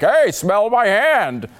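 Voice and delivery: sneering imitation of a creepy boast; mock Cuomo. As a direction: drawl it out like a smug politician. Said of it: "then be like".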